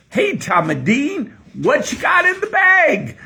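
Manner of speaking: loud, high hammed-up Kentucky accent; Southern accent. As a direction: holler it like a joke Southerner. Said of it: "I'm gonna have to talk like this".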